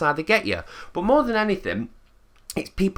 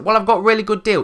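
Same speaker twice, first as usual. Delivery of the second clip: much higher, louder, fast pitch; pushy salesman; 8 semitones higher, 5 dB louder, faster than usual. Retold or imitated. Salesman pushing Joe fast, higher and louder. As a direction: rattle off the deal to keep her hooked. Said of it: "They are incredibly pushy".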